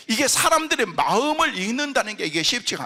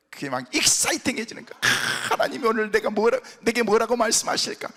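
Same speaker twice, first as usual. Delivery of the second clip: ordinary talk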